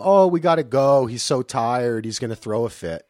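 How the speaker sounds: put-on fretting parent; fake parental excuse. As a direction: make a phony excuse to leave. Acting out excuse to leave early.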